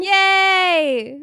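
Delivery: high, loud cheer; excited. Real, not acted. Celebrating episode one twenty.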